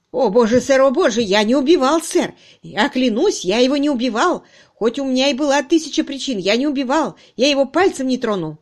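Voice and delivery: loud, high, frantic protest; terrified suspect pleading; panicked denial. Acted. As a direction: swear innocence in desperation. Suspect frantically denies murder.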